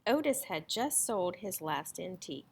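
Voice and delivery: plain reading voice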